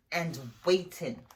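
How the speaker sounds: ordinary delivery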